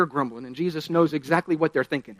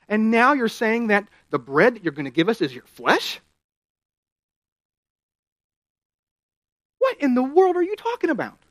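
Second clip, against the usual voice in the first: rising incredulous protest, peaks much higher; upset crowd; 5 semitones higher, 3 dB louder than usual. Retold or imitated. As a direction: protest in growing disbelief. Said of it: "they're really starting to get upset".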